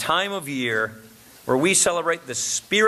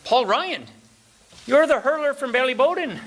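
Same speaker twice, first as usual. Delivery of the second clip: lightly voiced pub fellow, much higher and rising; a hint of an eager, starstruck local; 9 semitones higher, 3 dB louder than usual. A pub local who recognises the name, mildly excited and much higher. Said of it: "He says".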